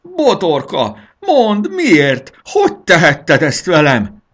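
high, loud aggrieved wail; howling. A narrator's reading. Dragon asks his wife why she kicked him, howling. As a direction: wail it out, deeply wronged. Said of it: "süvöltötte".

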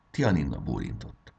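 ordinary delivery

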